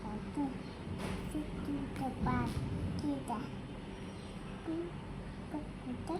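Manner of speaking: very soft, timid singing voice; barely audible. Real, not acted. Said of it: "জোরে বলো, শুনতে পাই না তো".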